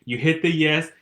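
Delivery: high, loud animated rant; excited. Real, not acted. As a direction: rant about it, worked up. Excited rant about polls.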